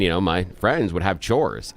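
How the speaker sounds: ordinary delivery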